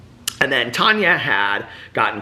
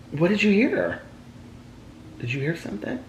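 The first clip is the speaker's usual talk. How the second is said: high, soft questioning coo; gentle high-pitched pet talk; 10 semitones higher, 6 dB quieter than usual. Real, not acted. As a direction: ask the pet in a small high voice. Breaks off to ask pet.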